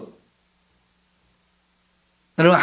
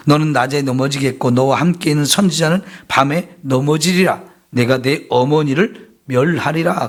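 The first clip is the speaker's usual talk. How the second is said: lower, measured reading of the verse; scripture reading; 5 semitones lower than usual. Acted. Preacher reading Hosea aloud to the congregation. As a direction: read the passage out steadily to the congregation.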